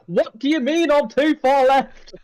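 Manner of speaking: high, drawn-out baffled protest; indignant disbelief. Retold or imitated. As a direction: replay the outraged confusion, pitch way up. Replaying his baffled reaction in race.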